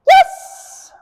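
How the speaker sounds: very high, loud bright yes; high marker yes. Shown as a demonstration. Trainer marking the dog's sit, high bright yes. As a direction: praise the dog with a sharp high yes. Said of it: "should be nice and high pitched".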